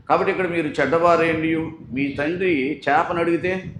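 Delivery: loud, slowing preacher's call; preaching at full force. Acted. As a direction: drive the point home to the congregation. Preacher raising voice to congregation.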